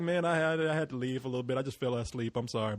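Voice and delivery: soft, sinking excuse; retold meek apology. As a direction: let it drop low and quiet, apologetically. Apologising to annoyed friend, retold.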